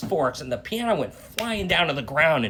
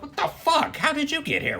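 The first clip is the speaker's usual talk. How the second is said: startled shock; 12 semitones higher than usual. Retold or imitated. Son appears unexpectedly.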